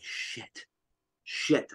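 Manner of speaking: breathy whispered swearing; hushed frustrated curse. Retold or imitated. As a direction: curse under your breath in dismay. Finding blood pile, dismayed.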